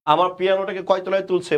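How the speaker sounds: normal talking voice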